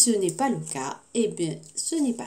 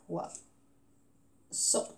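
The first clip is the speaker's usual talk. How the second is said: Poisson very softly, POI... long gap... SSON; syllable by syllable; 4 semitones lower, 12 dB quieter than usual. Shown as a demonstration. Teacher filling the boxes, Poisson softly split syllable by syllable. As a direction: murmur Poisson in two widely spaced syllables. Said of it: "Deux syllabes".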